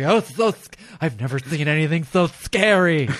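high, loud gushing fright from naive moviegoers; loud, high mock awe from a naive moviegoer; mock scared viewer. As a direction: gush like a Midwest fan who's never seen metal. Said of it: "They're like".

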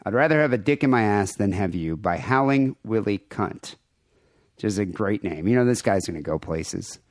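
usual speaking voice

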